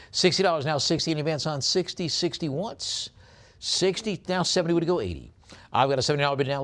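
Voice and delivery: quick, punchy bid calling, pitch jumping up on once and twice; auctioneer's call. Acted. Auctioneer calling bids to the online bidders, brisk and rhythmic. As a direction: rattle off the bids like a live auctioneer. Calls bids on coin lot.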